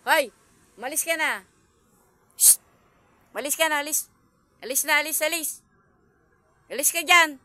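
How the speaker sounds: loud, high shouts to drive it off; shouted shooing. Real, not acted. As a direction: chase the cat off, yelling. Shooing the cat away.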